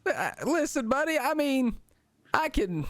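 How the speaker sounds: cheerful high kobold pal. Acted. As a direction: play a chirpy goblin-like kobold. GM voices kobold Dom.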